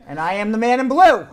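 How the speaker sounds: loud self-announcement; yelling. Real, not acted. Host announcing his blue outfit, yelling. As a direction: boom it out to the audience. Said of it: "Why am I yelling?".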